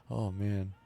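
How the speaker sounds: ordinary talk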